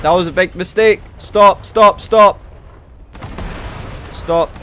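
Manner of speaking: high, loud cries of stop; panicked shouting. Real, not acted. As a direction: yell at them to stop. Match going wrong, yelling orders.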